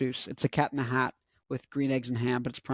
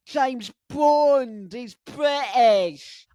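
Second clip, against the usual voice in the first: high, drawn-out hammed-up Scottish voice; Scottish impression; 8 semitones higher, 4 dB louder, much slower than usual. Vlogger claiming Scottish blood, exaggerated Scottish impression. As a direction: stretch it out in a loud Scottish burr. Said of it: "so I can do the impression".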